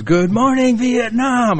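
high, stretched radio DJ call; playful high-pitched DJ greeting; Robin Williams. Retold or imitated. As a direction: belt it like a morning radio host. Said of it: "like Robin Williams did in a movie".

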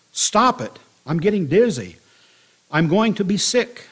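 storyteller's restrained scream of dizzy distress; mild narrated scream. A narrator's reading. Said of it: "I screamed".

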